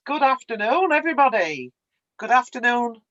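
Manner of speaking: usual speaking voice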